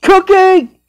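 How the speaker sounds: high yelled name; shouted cookie. Acted. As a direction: yell it sharply.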